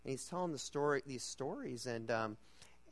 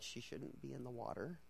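muttered aside; 12 dB quieter than usual. Retold or imitated. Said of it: "We're like".

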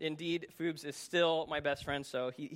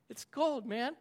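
reluctant friend; 8 semitones higher, slower than usual. Retold or imitated. Friend balking at the cold river, high mild protest. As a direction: lightly voice a reluctant pal, pitched up.

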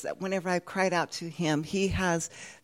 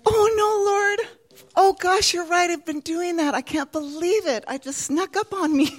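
high, loud dismayed gasp of realization; shocked realization; 11 semitones higher, 4 dB louder than usual. Retold or imitated. Karen, realizing her error, exclaims to the Lord in dismay. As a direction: caught out, she exclaims to God. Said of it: "It's like".

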